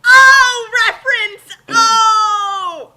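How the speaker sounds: loud, high excited cry; excited outburst. Real, not acted. Friend reacts to the chapter reference with a loud excited cry. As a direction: burst out at the reference.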